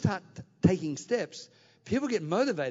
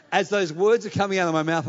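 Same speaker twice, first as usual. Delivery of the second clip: ordinary delivery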